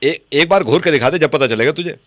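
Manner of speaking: ordinary talk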